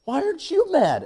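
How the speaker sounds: hammed-up incredulous outburst; exasperated disbelief. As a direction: squeal the question in retold frustration. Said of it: "I'm like".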